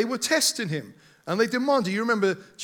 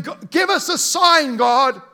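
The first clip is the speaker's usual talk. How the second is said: sneering, raised demand for proof; cynical demand; 3 semitones higher, 6 dB louder than usual. Retold or imitated. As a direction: demand a sign with cynical edge. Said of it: "from a cynical heart saying".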